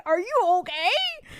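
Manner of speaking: high-pitched panicked question, rising at the end; freaking out. Retold or imitated. Daughter calling mom after fire text, freaking out. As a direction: play up the frantic worry. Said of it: "freaking out".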